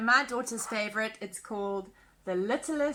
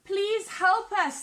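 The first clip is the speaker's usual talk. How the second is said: high, louder, slow plea for help; freezing dragons calling out a slow, higher plea; pleading cry; 8 semitones higher, 3 dB louder, much slower than usual. Acted. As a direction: beg for help, calling out slowly. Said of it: "they all cried".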